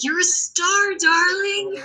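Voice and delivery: very high, theatrical rock-star compliment. Retold or imitated. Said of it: "I said exactly like that".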